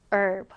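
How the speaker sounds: "herb" said as "erb", no H; "herb" starting straight on the vowel; silent-H herb. Shown as a demonstration. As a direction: drop the H in "herb", American style. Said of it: "H 발음이 없어요".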